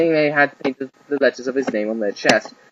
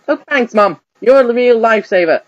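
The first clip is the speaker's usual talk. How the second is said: put-on higher, louder grateful boy; relieved boy; 6 semitones higher, 6 dB louder than usual. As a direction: gush thanks like a kid saved. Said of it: "said a relieved Ash".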